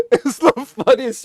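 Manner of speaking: can't hold back the laughter; laughing. Real, not acted. Laughing at Thor flung out.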